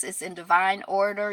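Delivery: ordinary talk, nothing marked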